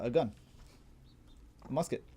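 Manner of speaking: normal talking voice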